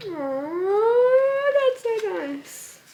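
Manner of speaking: high, slow cooing aww; touched aww. Real, not acted. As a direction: melt over the gift, voice rising high. Opening a gift with her name.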